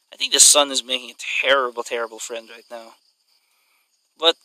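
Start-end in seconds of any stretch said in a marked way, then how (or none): none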